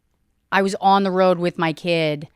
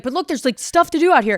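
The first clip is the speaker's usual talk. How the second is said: cheerful parent voice; 8 semitones higher, 5 dB louder than usual. Retold or imitated. Coaxing her child at castle.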